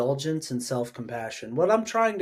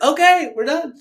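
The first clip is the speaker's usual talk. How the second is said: high, loud fed-up exclamation; father fed up with the interruption, high-pitched; exasperated parent; 12 semitones higher, 7 dB louder than usual. Retold or imitated. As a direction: throw up your hands, mock exasperation. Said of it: "I was like".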